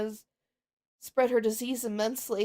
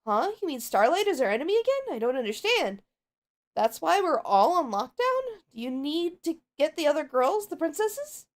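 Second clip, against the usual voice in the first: higher, bubbly pony voice for Pinkie; Pinkie Pie voice; 5 semitones higher, 3 dB louder than usual. Acted. Pinkie greets Twilight in a higher, bubbly voice. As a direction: play the cheerful pink pony greeting her friend. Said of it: "possibly I'll try and do something for Pinkie Pie".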